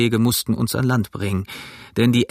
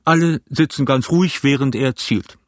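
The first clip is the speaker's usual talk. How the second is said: raised captain's command; steady commanding call; 3 semitones higher than usual. A narrator's reading. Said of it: "rief der Kapitän".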